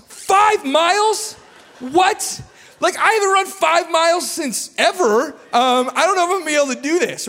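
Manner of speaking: high, loud mock-shocked cry; incredulous outburst. Novice runner hearing "five miles" at first group run, hammed-up shock. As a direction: play up disbelief, pitch leaping way up.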